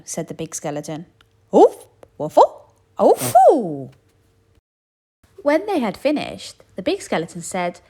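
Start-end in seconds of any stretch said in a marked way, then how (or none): 1.5-3.9 loud, high, slow straining grunts; effortful grunts. A narrator's reading. Skeletons struggle to fit bones, grunting loudly. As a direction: heave and grunt.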